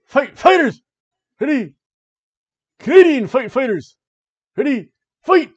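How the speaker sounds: loud, high arcade announcer call; booming fighting game announcer. Acted. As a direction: boom it out like an arcade fight intro.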